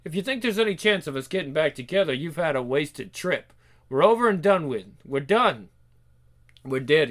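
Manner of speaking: lightly acted Scottish rejection; mildly angry Scot ending it; bitter Scotsman. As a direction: shut down the reunion firmly. Reads breakup argument from comic.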